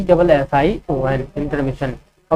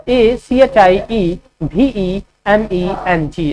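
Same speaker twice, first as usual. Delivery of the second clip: slow letter-by-letter dictation; slow spelling dictation; spelling out slowly; much slower than usual. Shown as a demonstration. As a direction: spell each letter clearly for students. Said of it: "achievement का spelling क्या होगा".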